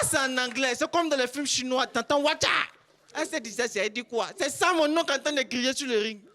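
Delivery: ordinary talk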